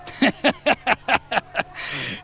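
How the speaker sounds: high, drawn-out maniacal cackle; villain's laugh. A narrator's reading. Wolf laughing maniacally at Stephanie's question. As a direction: laugh long and madly. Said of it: "he laughed".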